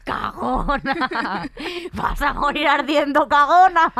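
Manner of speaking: slow drawn-out taunt that ends in a loud shout; mock her like a vengeful God. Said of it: "Le decía".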